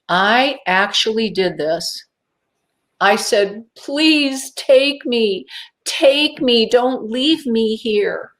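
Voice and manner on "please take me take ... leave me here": drawn-out high plea; acted-out begging; pleading. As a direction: beg them slowly, stretching each word. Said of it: "my insides were screaming, literally begging them".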